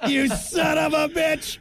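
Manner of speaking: high, slow shouted curse; replayed outraged yell. Replays own outburst from the video.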